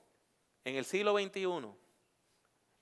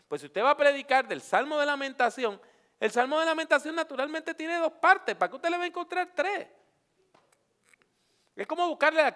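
much higher and louder, preaching hard; preaching at full force; 12 semitones higher, 7 dB louder than usual. Real, not acted. Preacher warning students off a third point, loud and high. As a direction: press the point home from the pulpit.

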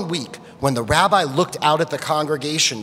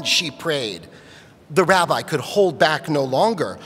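plain reading voice

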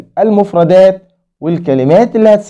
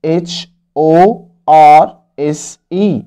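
H O R S E spelled letter by letter, very slow; slow spelling; much slower than usual. Shown as a demonstration. Teacher spelling horse slowly for kids. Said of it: "بتبدأ بحرف ال H".

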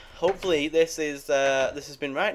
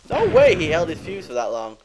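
loud, disbelieving outburst; astonished; 4 semitones higher, 8 dB louder than usual. Real, not acted. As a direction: cry out in disbelief. Creeper explodes late.

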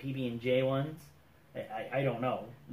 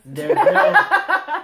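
laughing; 13 dB louder than usual. Real, not acted. Laughing at a silly question.

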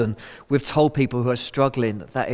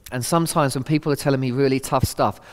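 ordinary talk, nothing marked

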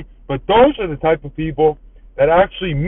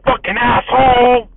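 imitating an indignant yell, drawn out and higher; retold angry shout; 6 semitones higher, 4 dB louder than usual. Stranger who held the door yells after him, mock angry shout. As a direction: throw out a mock insult, higher and slow.

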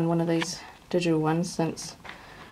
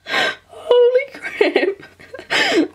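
very slow, high cry through laughter; real tearful elation; overjoyed disbelief; 6 dB louder, much slower than usual. Real, not acted. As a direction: squeal it out, laughing near tears. Overjoyed at clear line.

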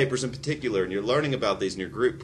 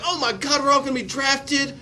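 hammed-up panic, much higher; much higher, frantic mock panic over being drafted; mock freakout; 14 semitones higher, 6 dB louder, slower than usual. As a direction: wail it like a frightened student. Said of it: "you're all freaking out and you're like".

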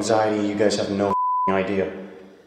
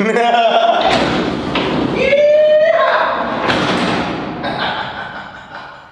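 real high-pitched laughing fit; long high laugh. Real, not acted. Laughing at the creepy sheriff teasing, high drawn-out laugh. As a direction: laugh high, letting it run on.

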